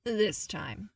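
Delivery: ordinary delivery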